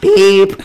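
high, loud mock honk; car horn beep.

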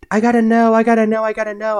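high, loud, fast pleading; eager pestering. Retold or imitated. Mimicking his friends begging for news, high and fast. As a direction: bounce it like a dying-to-know friend.

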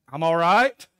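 loud, awkward call-back; yelled back. Retold or imitated. Embarrassed man answers the voice in the next stall, yelling back. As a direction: call it out through the stall wall. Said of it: "I just yelled back".

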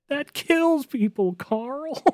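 high-pitched cartoon voice, stretched out; squeaky, drawn-out reproach; cartoon llama scolding. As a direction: scold Carl like an appalled cartoon character. Quoting a Llamas with Hats cartoon.

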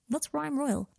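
ordinary delivery

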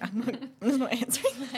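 laughing refusal. Retold or imitated. Refusing the therapist's question.

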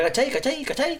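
high, lilting Chilean "cachai" said over and over; playful Chilean imitation; Chilean accent. Shown as a demonstration. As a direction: chant "cachai" like a Santiago local. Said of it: "tienen un cantao".

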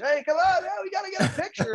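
excited shout. Retold or imitated. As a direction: burst in eager for a photo. Kyle Dubas greeting him, quoted.

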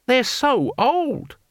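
high, very drawn-out disbelief; incredulous. A narrator's reading. Tax gatherer to teacher about old fighters, incredulous. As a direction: wail it slowly in astonishment.

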